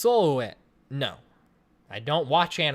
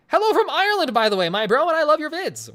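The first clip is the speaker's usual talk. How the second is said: excited chat reading; 8 semitones higher, 6 dB louder than usual. Acted. Reading a viewer's chat greeting.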